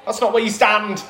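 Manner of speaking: high, loud comic panic; exasperated calling out. Acted. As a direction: shout orders at a wayward team. Organiser panics, calling out to Denmark.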